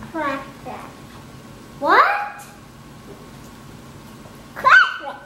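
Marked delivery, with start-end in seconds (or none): 1.8-2.4 loud, high rising "what?"; real puzzled outburst; baffled asking. Real, not acted. Cannot understand little sister's answer. 4.5-5.2 real high-pitched exclamation; insistent repeat. Real, not acted. Repeats word for uncomprehending sibling.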